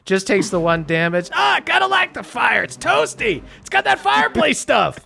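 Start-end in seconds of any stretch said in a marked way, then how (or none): 1.3-5.0 Petty Jack voice. Acted. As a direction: crow it high and pleased. Goon enjoying the fire.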